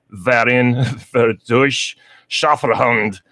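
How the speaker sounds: slow, hammed-up German accent; mock German accent. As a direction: relish the German club name in a playful German accent. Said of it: "talk in a German accent every single time you say it".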